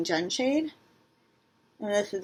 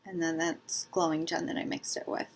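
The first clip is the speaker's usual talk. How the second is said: ordinary talk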